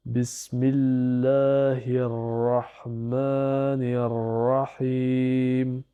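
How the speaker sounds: very slow, every shadda and long vowel marked; slow careful recitation. Shown as a demonstration. Teacher pronouncing every shadda for learners, very slow. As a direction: lean on each doubled consonant. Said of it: "je prononce bien toutes les shaddas".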